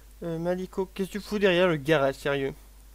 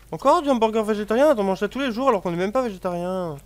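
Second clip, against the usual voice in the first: loud, high mocking protest; indignant complaint; 5 semitones higher, 6 dB louder than usual. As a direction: complain in disbelief. Mock complaining as game character.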